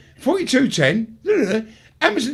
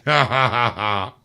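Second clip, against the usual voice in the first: fake laugh; 9 semitones lower, slower than usual. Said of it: "laughing faces up".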